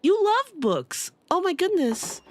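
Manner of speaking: high, slow gush of delight; thrilled, high-pitched cry; delighted squeal. Real, not acted. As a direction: squeal with joy at the news. Player delighted by revelation.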